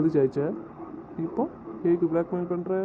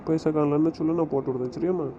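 usual speaking voice

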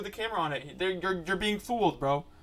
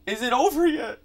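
terrified cry; 5 semitones higher, 11 dB louder than usual. Real, not acted. Horror scare peak.

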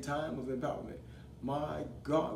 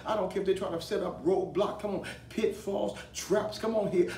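higher-pitched, driving sermon delivery; impassioned rallying of the congregation; full-force preaching; 5 semitones higher, 4 dB louder than usual. Real, not acted. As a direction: preach it hard, punching each phrase.

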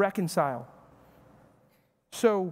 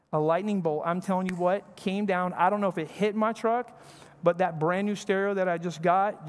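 normal talking voice

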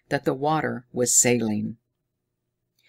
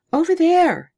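high, loud breathless cry; panting alarm; 9 dB louder than usual. A narrator's reading. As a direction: gasp it out in alarm. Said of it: "panted Haidia".

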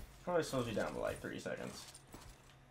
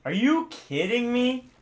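high, slow, loud disbelief; exasperated yell; 11 semitones higher, 6 dB louder, slower than usual. Real, not acted. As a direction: drag it out in disbelief. Gamer fails again.

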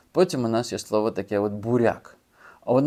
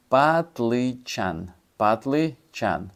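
Потім у нас патличан, drawn out slowly syllable by syllable; slow careful pronunciation; much slower than usual. Shown as a demonstration. Teacher slowly sounding out патличан for learners, drawn out. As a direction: stretch патличан out slowly so learners can follow. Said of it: "slowly spelling out new word".

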